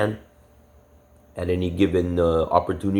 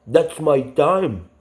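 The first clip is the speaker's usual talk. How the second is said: mock uncle quote; 8 semitones higher, 4 dB louder, much slower than usual. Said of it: "he said something like".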